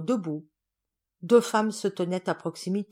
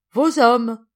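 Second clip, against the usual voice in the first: gleeful; 4 semitones higher, 10 dB louder than usual. Acted. Delighted hostess laughing.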